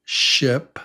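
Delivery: ship with a short, relaxed I. Shown as a demonstration. Teacher models ship for the class, short I. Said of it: "we have ship".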